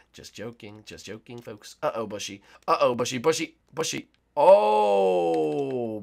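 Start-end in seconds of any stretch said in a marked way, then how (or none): none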